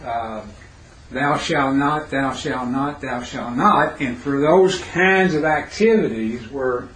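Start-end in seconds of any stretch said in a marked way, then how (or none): none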